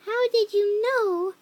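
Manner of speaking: high, loud, drawn-out astonishment; surprised question. A narrator's reading. Girl astonished that Voldemort knows, surprised question. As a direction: ask it amazed, stretching it out. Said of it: "I asked in a surprised way".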